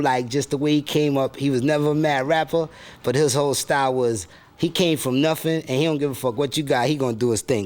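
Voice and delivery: a hint of the high put-on rapper voice; mad rapper persona, lightly. Rapper alter ego dodging interviewer's questions, lightly put-on voice. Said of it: "Mad rapper, is there any artists".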